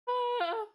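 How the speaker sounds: high, loud "ah" of overwhelm; playful overwhelmed cry; mock cry. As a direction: let out a sudden mock wail. Mimics being hit by friend's energy.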